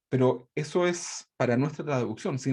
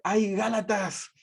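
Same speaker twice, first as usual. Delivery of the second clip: exasperated scolding; 6 semitones higher, much slower than usual. Retold or imitated. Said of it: "En la traducción al lenguaje actual dice".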